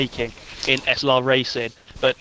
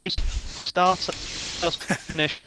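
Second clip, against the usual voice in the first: choppy, cutting-out audio; breaking up over a bad connection; laggy video call; 2 semitones higher, 6 dB quieter, much slower than usual. Real, not acted. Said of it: "I didn't hear exactly everything you were saying there".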